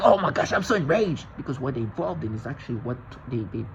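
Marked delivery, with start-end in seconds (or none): 0.0-1.3 higher-pitched imitation of fake outrage; mock enraged. Mimicking the gaslighters who act enraged, mock outrage. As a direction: put on exaggerated outrage, mocking the accusers. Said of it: "So they're like".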